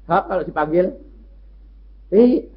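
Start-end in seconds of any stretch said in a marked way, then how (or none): 2.1-2.5 imitating a sweet maid, higher and louder; soft-voiced maid. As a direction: put on a sweet obedient maid voice. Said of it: "suaranya si halus". (menirukan suara pembantu yang halus, lebih tinggi)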